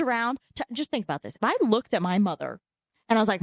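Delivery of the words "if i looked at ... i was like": ordinary talk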